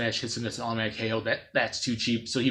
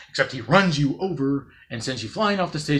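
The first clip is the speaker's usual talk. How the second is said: ordinary talk, nothing marked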